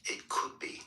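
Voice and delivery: hushed storybook narration; whispery read-aloud narration; 1 story reading. Acted. Reads Grinch story.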